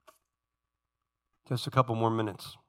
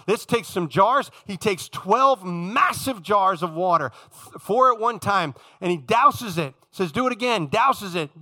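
higher-pitched, emphatic preacher delivery; impassioned preaching; 7 semitones higher, 5 dB louder than usual. Acted. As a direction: preach it with rising fervour, landing hard on key words. Preaches at full force.

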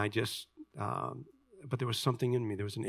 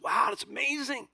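high-pitched mock amazement; put-on wowed exclamation, voice way up; amazed reaction; 11 semitones higher than usual. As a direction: imitate a gushing, impressed listener. Imitates an impressed conference attendee.

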